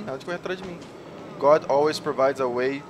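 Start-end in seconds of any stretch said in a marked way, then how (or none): 0.0-0.8 the same words again, softer, under the breath; quieter repeat. Real, not acted. Player repeating the warning to himself, softly. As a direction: mutter it to yourself the second time.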